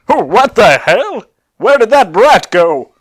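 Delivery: high, loud, slow villain yell; loud drawn-out outraged villain voice; shocked Cell. Acted. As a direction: bellow it out like a startled villain.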